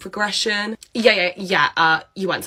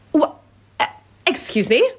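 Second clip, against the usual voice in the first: indignant disbelief; 9 semitones higher than usual. Acted. Boss's indignant reaction.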